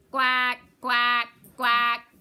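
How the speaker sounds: loud quacking duck greeting at the door. Acted. Little Duck greets the sheep.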